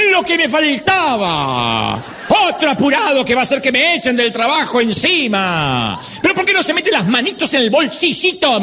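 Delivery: put-on high, shrill-free angry scold of an old lady; mock angry old woman scolding; scolding cashier. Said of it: "Y dice, ¡ja!".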